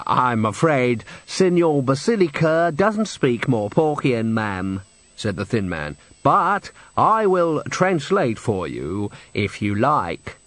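slow, drawn-out formal courtesy; prim thin man. Acted. Manager offers to translate for the witches, slow and prim. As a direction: spell it out with stiff politeness.

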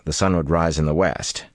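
ordinary delivery, nothing marked

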